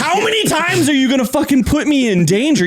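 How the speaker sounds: half-laughing scold of a fed-up driver; imitating the girl. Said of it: "I'm sure she was like".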